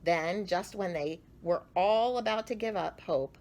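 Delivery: ordinary delivery, nothing marked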